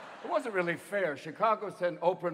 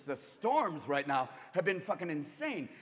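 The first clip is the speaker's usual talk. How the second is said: ordinary talk, nothing marked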